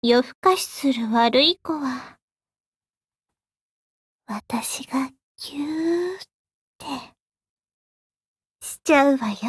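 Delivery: close, hushed devil whispering; ASMR whisper. Acted. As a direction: whisper softly into the ear.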